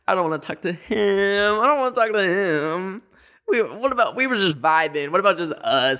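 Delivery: high, pleading I don't wanna talk to him; begging the assistant not to call the owner, high and nervous. Retold or imitated.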